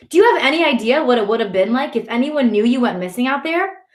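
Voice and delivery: high, rising exasperated questions; demanding. A narrator's reading. Brother asking Ali about worrying Mama, demanding. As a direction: scold with indignant questions. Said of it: "my brother demands".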